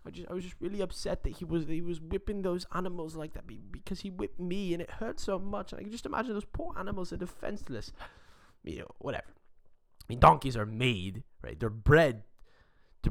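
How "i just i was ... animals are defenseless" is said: put-on higher, quick, soft voice of the upset girl; girl explaining. Girl answering the worried couple, higher and soft. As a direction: play her as shaken but explaining. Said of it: "and she's like".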